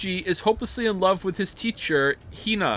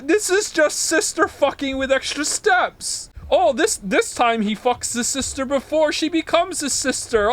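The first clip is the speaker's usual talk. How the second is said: shocked outrage; 7 semitones higher, 4 dB louder than usual. Real, not acted. Outraged reading anime synopsis.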